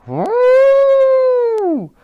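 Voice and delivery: long, very high loud "whoo"; mammoth hoot. A narrator's reading. The shot parts the mammoth's fur, startled high hoot.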